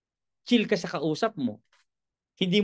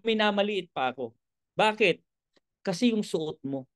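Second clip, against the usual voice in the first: ordinary talk, nothing marked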